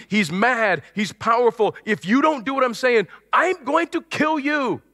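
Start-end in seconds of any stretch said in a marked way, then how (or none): 1.8-4.8 raging king. Retold or imitated. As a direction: threaten like a furious tyrant. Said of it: "the king is furious, he's raging".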